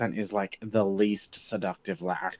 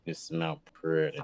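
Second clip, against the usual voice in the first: seductive voice; 5 semitones lower, 4 dB quieter than usual. Pretend. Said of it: "make it, like, all seductive-y".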